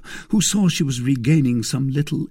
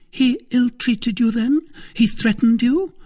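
high, louder, urgent questions; anxious wife; 5 dB louder than usual. Acted. Wife asking if he was threatened, alarmed. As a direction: press him in alarm.

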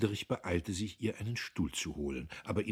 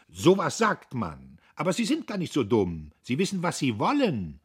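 louder and higher, daring him; defiant; 9 semitones higher, 9 dB louder than usual. A narrator's reading. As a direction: toss it out as a challenge. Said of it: "schloss sie herausfordernd". (herausfordernd, lauter und höher)

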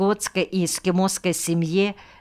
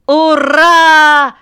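high, loud drawn-out cheer; children's shout; 11 semitones higher, 12 dB louder than usual. A narrator's reading. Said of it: "закричали дети".

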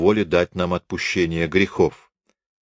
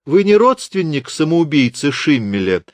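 higher, light chirpy question; a lightly voiced lady chirping higher; 7 semitones higher, 3 dB louder than usual. A narrator's reading. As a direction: chirp it sweetly, feigning innocence. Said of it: "щебечет голосок Рене".

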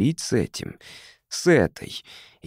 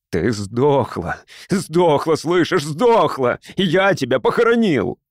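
hysterical shouting; 5 semitones higher, 3 dB louder than usual. A narrator's reading. Husband screams at the corpse.

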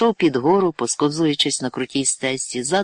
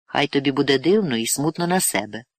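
ordinary delivery, nothing marked

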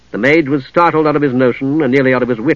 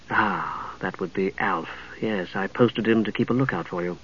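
low, hushed, knowing woman; composed lady knight; 5 semitones lower, 12 dB quieter than usual. Acted. Lady knight owning up to her lookout, quietly knowing. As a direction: answer softly, in a lower cultured voice. Narrator voices Lady Catherine.

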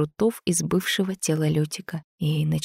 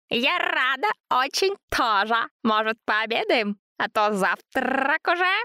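piglet voice; 8 semitones higher than usual. A narrator's reading. Piglet Zina's voice.

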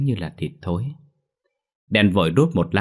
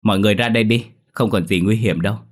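louder call to people far off; reassuring the hiding mourners, calling loudly; 2 semitones higher, 5 dB louder, faster than usual. Acted. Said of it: "hắn liền gọi lớn".

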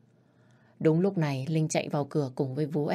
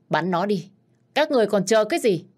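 angry shouted order; 5 semitones higher, 6 dB louder than usual. Acted. Takashi yelling at his men to shoot the traitor, furious. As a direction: shout it fast and loud, higher in pitch.

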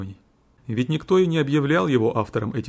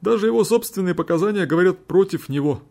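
higher, earnest courtroom admission; lawyer conceding in court; 7 semitones higher, 3 dB louder than usual. A narrator's reading. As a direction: admit it plainly to the judges.

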